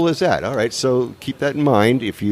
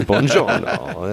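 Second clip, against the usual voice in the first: slow Italian greeting through a laugh; laughing buongiorno; much slower than usual. Real, not acted. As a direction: laugh while echoing his buongiorno. Echoing chef's Italian greeting.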